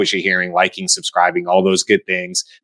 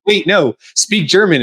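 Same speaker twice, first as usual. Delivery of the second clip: higher-pitched, pleading interruption; mock protesting; 9 semitones higher, 3 dB louder, slower than usual. Friend to Germans who want English, protesting. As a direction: cut in with a raised-pitch mock plea. Acted plea to keep speaking German.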